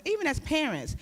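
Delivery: normal talking voice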